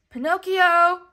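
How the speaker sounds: loud, high call into the night; worried father's loud call. A narrator's reading. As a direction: search for a lost son, calling. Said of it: "Geppetto called out until his throat was sore".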